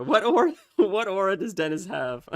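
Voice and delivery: cracking up while asking; amused disbelief through laughter; laughing. Real, not acted. Laughing at Dennis remark.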